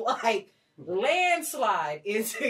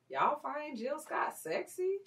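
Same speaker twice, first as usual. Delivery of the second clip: slow, raised, insinuating read; suggestive; 6 semitones higher, 5 dB quieter, slower than usual. Retold or imitated. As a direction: drag it out with a suggestive twist. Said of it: "The way you said it, it was a lot of twist on the words".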